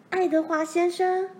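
loud, high-pitched excited call; saying it aloud. A narrator's reading. Daughter guesses who it was, calling out loudly. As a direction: burst out with the name. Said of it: "罗兰大声说出来".

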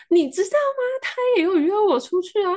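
imitating a friend spilling big news; excited gossip. Retelling the Colombian friend's boast to her friend, high and excited.

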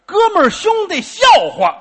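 high, loud objection; mock-heated protest. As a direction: fire it back at him. Heated back-and-forth of objections.

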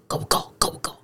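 压低声音，用一点气音轻轻问; 学情侣在影院里小声问来问去; mock whisper. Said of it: "一直用气音讲话". (轻轻模仿男生用气音问女友够不够)

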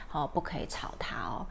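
soft whisper. Real, not acted. Teacher whispers to not wake baby.

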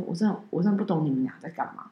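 exasperated coworker. Retold or imitated. Coworker shrugging off their odd trip, soft and fast. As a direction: mutter it quickly, half giving up.

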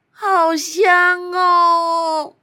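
long, dreamy sigh of delight; savoring. Acted. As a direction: savor the smell slowly. Smelling the berries.

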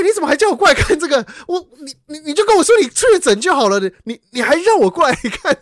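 high, loud stammering complaint; heated reenacted scolding; retold anger. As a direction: rant in exasperation, tripping over words. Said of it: "我那时候就有点生气".

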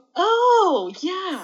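slow, drawn-out, high Oh yeah; a lost student bluffing along; mock clueless reply. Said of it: "然后我就". (慢吞吞地、高音假装听懂)

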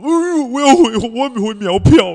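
high, loud wailing whine; crying teammate impression. Teammate sobbing to his coach, high loud whine. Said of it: "学得很像".

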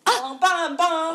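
high, loud mock cheer; excited shout. Real, not acted. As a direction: yell the praise with glee.

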